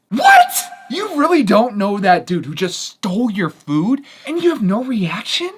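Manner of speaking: high, loud incredulous outburst; shrieking what-are-you-serious; exaggerated disbelief. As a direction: overplay the shock for the retelling. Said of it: "But much nicer, of course".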